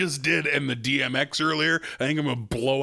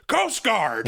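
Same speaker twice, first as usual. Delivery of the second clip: higher, punchy mock colonel bark; colonel deadpan; 8 semitones higher, 3 dB louder than usual. The colonel labels Kiefer Sutherland's credit, mock gruff. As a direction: toss it off like a gruff officer. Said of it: "he goes".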